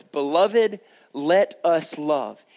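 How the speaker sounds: every word slowed and weighted; slow emphasis. Acted. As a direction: let each word land. Repeating key verse slowly.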